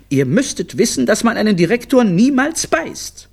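high-pitched, admonishing mum; stern mother's high lecture; scolding mother. Acted. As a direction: lay down the law in a high voice. Mother scolds her children.

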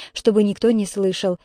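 usual speaking voice

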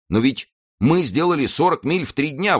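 high-pitched, protesting outcry; indignant exclamation. A narrator's reading. Planchet defends his ride to his reproachful master, exclaiming. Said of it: "воскликнул Планше".

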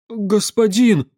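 high, drawn-out frightened plea; frightened babbling. A narrator's reading. Peasant babbles to the angry master, frightened plea. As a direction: plead in a high, slow voice. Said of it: "залепетал тот едва слышно".

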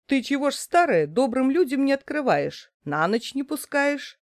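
high, fast soldier scolding. Acted. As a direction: rebuke the old woman briskly. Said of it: "говорит солдат".

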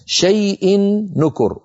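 شيء نكر slowly, tanween merged into a long held nūn; full idgham. Shown as a demonstration. Teacher reciting a board example to students, full idgham. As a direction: recite شيء نكر with a drawn-out nasal n joining the words. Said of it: "هذا علامة الإدغام الكامل".

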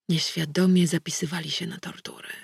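ordinary delivery

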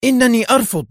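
loud, high-pitched angry refusal; furious cry. A narrator's reading. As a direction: burst out in exasperation, loud and high. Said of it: "هتفت في حنق". (صيحة حانقة مرتفعة)

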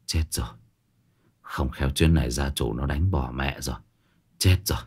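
quiet despair. Acted.

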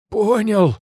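high loud cry of pain; hit hard crying out. Acted. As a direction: yelp it out while in agony. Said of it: "прохрипел он".

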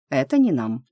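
plain reading voice

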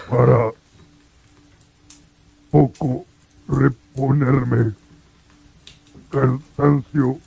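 high, slow broken speech with long gaps; halting creature. Acted. Creature asking the blind man for shelter, slow and halting. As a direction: labour over each newly learned word.

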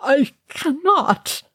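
high, slow, breaking plea; crying out. A narrator's reading. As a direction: cry it out in despair. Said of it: "Balin cried".

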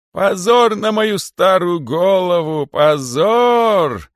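high, loud wailing shout; a well-practiced fit of hysteria; rehearsed hysterics. A narrator's reading. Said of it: "забился великий питон в хорошо отработанной истерике". (отрепетированная истерика, громкий вопль)

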